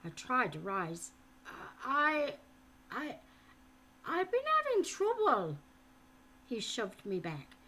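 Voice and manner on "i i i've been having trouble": put-on higher, stammering boy; frightened stammer. A narrator's reading. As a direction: stumble over the words in fear.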